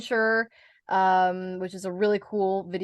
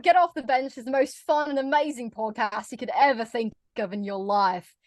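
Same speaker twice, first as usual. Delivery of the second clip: Australian accent demo, matey opening; 2 semitones higher than usual. Shown as a demonstration. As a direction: put on an Aussie accent for show. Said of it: "Have you had to do an Australian accent".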